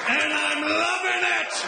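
high, drawn-out, half-sung glee; gleeful gloating. Acted. Comic confessing he enjoys gambling on the elderly, gleeful. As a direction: stretch it out high, relishing it.

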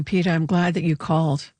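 ordinary talk

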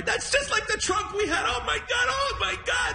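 very high, wailing blubber; hammed-up tearful squeal of a moved father; mock crying. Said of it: "everything made him cry".

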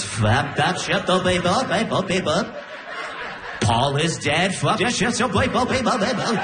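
slurred looping gibberish; garbled sleep talk. Retold or imitated. Girlfriend still babbling in tongues in her sleep. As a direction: chant the nonsense half-awake.

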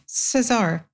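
ordinary talk

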